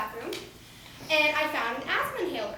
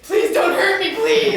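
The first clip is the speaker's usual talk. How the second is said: mock sobbing plea; 6 semitones higher, 11 dB louder, slower than usual. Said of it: "I'm sobbing".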